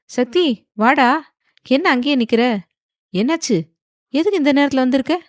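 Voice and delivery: high-pitched, concerned questioning; puzzled surprise. A narrator's reading. Startled uncle questions his late-night visitor, puzzled and high.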